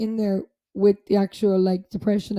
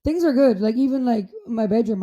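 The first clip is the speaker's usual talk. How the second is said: ordinary talk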